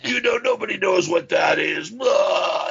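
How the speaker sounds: high-pitched parody stage shout. Retold or imitated. Metal singer ranting between songs, hammed-up shouting impression. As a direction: keep up the mock frontman holler. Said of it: "he'd just be like".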